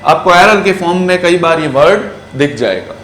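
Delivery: usual speaking voice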